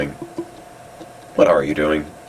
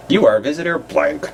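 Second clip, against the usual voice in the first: high-pitched web page voice; mock announcement; 8 semitones higher than usual. Reading a broken visitor counter, high mock announcement.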